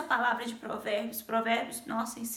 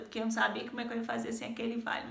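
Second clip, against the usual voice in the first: usual speaking voice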